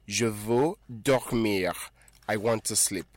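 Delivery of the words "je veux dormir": slow careful model. Shown as a demonstration. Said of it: "The verb to sleep in French is".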